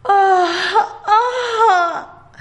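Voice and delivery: two long high yawning sighs; drowsy double yawn; big yawns. A narrator's reading. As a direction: stretch and yawn twice, sleepily. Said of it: "Bostezó dos veces".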